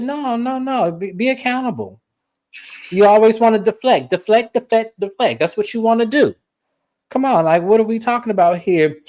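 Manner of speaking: mock scolding. Retold Angie scolding Brahma.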